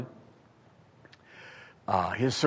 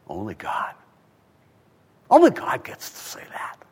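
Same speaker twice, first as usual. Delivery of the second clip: hushed whisper with reverent awe; awed whisper. Real, not acted. Preacher answers who can say it, in an awed whisper. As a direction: breathe it out in a whisper. Answers his own rhetorical question.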